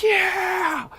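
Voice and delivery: high excited cheer. Retold or imitated. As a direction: yell it like cheering him on. Cheering friend on bike.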